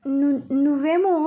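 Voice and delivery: slow, bashful stumble over the word; a shy young man faltering, lightly voiced; coy, stammering hesitation. A narrator's reading. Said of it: "చిన్నగా నవ్వుతూ సిగ్గుపడుతూ".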